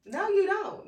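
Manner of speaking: loud, high, mocking no; sharp retort. Real, not acted. Friend calling out fake forgiveness, loud and mocking. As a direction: shut down the fake forgiveness loudly.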